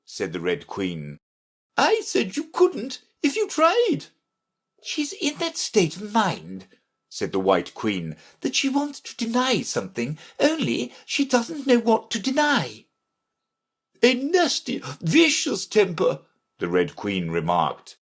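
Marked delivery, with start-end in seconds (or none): 1.8-4.1 very high-pitched imperious voice; retorting Red Queen. Acted. Red Queen correcting Alice, high and emphatic. 4.8-6.7 high-pitched queen voice. Acted. 8.4-12.9 high-pitched, fretful queen. Acted. 14.0-16.2 high-pitched, condemning queen; disapproving Red Queen. Acted. Red Queen judging Alice, high and severe.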